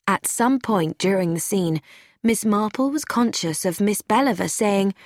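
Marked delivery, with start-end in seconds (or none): none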